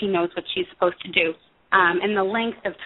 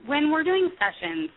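ordinary talk, nothing marked

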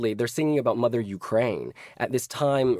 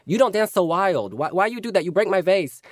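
higher, louder scolding mother voice; imitating mother; 8 semitones higher, 5 dB louder than usual. Mother scolding her child for dancing on the fireplace, higher and louder. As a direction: mimic a mum telling her child off in broken English. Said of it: "my mother would always say".

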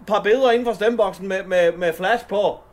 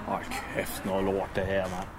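muttered curse; 12 semitones lower, 12 dB quieter than usual. Real, not acted. Cursing after failed vote.

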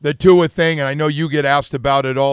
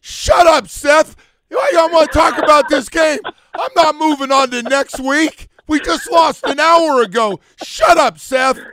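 high, loud heckling, re-enacted; yelling at the radio; 12 semitones higher, 5 dB louder than usual. Host re-enacting himself in the car cheering O J on, yelling. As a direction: holler at the show like a worked-up listener. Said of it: "in my radio in the car going".

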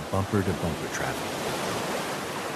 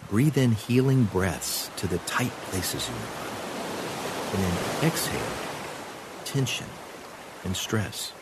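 calm meditative tone. Acted. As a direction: guide the breath calmly. Guided breathing exercise.

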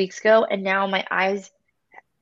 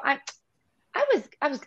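ordinary delivery

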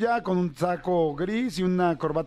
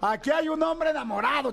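high, gleeful exclamation; excited announcement; 8 semitones higher than usual. Real, not acted. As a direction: proclaim it like big news. Host repeats it with glee.

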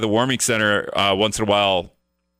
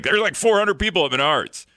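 higher-pitched mock exaggeration; sarcastic; 8 semitones higher than usual. Host quoting friend about the packed Menards lot, sarcastic. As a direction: imitate a friend overstating it with light sarcasm. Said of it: "He's being a little sarcastic".

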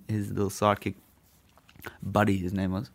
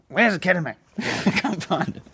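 mocking the cop; 9 semitones higher than usual. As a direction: play the cop as a flustered searcher.

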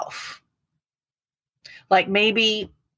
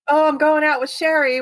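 higher, louder, falsely breezy; innocent lie; 9 semitones higher, 4 dB louder than usual. Retold or imitated. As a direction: sell the fib brightly. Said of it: "I'm gonna be real clever".

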